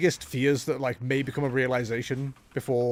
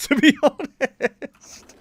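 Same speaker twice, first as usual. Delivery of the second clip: high, drawn-out laughing words; 11 semitones higher, 7 dB louder, much slower than usual. Real, not acted. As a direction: let the laugh stretch the words out. Laughs through own remark.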